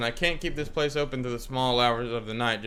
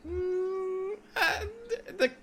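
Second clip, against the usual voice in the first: put-on crying old man; 6 dB quieter, much slower than usual. Old man tells sad story.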